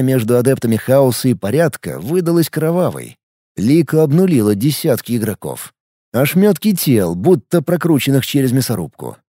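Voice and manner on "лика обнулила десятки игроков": ordinary delivery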